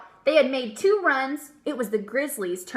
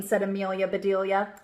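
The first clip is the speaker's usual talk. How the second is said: ordinary delivery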